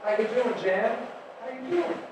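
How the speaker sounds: put-on high soft baby talk to a pet; soft cooing pet talk, ending on a high rise; cooing to a cat. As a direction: coo it up high like to a cradled kitten. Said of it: "cradle him upside down and say".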